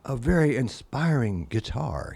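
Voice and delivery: put-on [name]'s accent. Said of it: "he said in his accent that he has".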